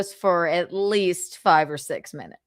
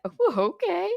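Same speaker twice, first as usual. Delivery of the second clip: much higher, airy ooh; playful surprised okay; mock surprise; 11 semitones higher than usual. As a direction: act taken aback but polite. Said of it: "I went".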